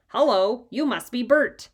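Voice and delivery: a hint of a puppet voice; lightly voiced Ernie puppet; Sesame Street voice. A narrator's reading. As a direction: play it like a TV puppet. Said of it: "in his best Sesame Street voice".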